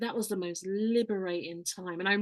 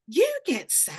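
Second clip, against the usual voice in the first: high, louder wondering question; amazed child; 6 semitones higher, 6 dB louder, slower than usual. Retold or imitated. Child asking mum if she gets sad, high amazed. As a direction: mimic a toddler's disbelief. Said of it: "child asks mum".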